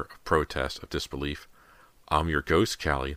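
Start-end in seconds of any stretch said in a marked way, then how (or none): none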